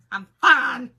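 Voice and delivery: loud, strained "I'm fine"; mock insistent shout. Insisting to the neighbor she's fine, loudly. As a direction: yell "fine" like she's clearly not fine.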